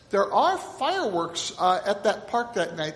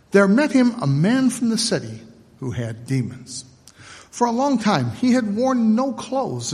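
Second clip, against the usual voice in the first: louder, formal scripture reading; Gospel reading; 5 semitones lower, 6 dB louder than usual. Acted. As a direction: proclaim the Gospel to the church. Reads the Gospel lesson.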